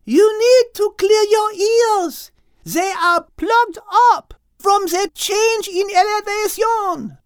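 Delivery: high, loud shout. Acted. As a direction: call out to everyone from a rock. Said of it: "Liz shouted".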